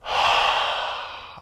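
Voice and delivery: long open-mouth breathy exhale; steamy exhale through a wide mouth; ocean breath. Shown as a demonstration. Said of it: "Here's my last one".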